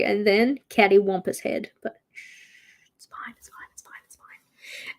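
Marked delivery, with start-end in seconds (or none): 1.8-5.0 soft conspiratorial whisper; hushed whisper. Acted. Hushing her own worry to chat, whispered. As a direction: whisper it like a secret to yourself.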